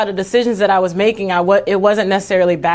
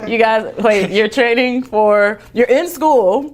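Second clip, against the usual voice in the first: raised pitch, stretched-out words in disbelief; mock incredulity; 3 semitones higher, slower than usual. Student retelling her amazement to the weightlifters, incredulous. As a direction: spell it out slowly as if astonished. Retold disbelief at athletes' news.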